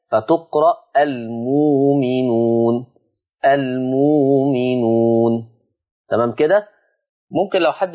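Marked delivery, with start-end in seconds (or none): 1.0-5.3 المؤمنون said slowly, the hamza turned into a long waw: al-muu-minuun; المؤمنون spoken very slowly, no glottal stop, long uu; slow pronunciation demo. Shown as a demonstration. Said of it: "فيه إبدال الهمز الساكن بواو مادية".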